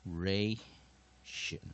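slow "operation" broken into separate syllables. Shown as a demonstration. Teacher introducing a new word, operation syllable by syllable. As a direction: pause between the syllables of "operation", very slowly.

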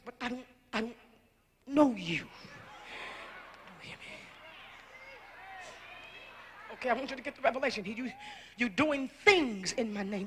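low, intimate hushed sermon voice; hushed preaching. Acted. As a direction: bring it down to a murmur. Long hushed, intimate preaching passage.

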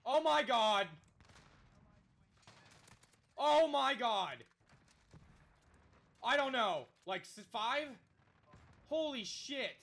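loud high shout of disbelief; excited yelling. Real, not acted. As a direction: yell it in shock. Yelling at a wild play.

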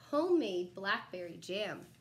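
ordinary delivery, nothing marked

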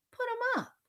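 high, loud, feisty dare; fighting challenge. A narrator's reading. Elaine raises fists at Daniel, fighting challenge.

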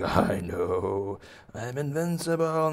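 drawn-out gloat climbing high; gloating baby bunny. Acted. Bunny gloating to helpless townsfolk, rising boast. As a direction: swell from your own pitch into a high boast. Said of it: "And he's like".

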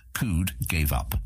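ordinary delivery, nothing marked

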